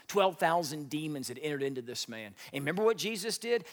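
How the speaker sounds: usual speaking voice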